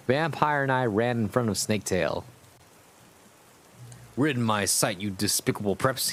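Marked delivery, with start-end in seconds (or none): none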